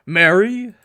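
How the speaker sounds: Mary? rings out, the throat now clear; husband finally calling his wife's name, loud. Acted. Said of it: "once more attempt to voice her name".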